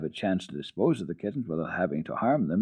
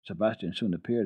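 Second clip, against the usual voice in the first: usual speaking voice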